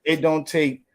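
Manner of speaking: ordinary delivery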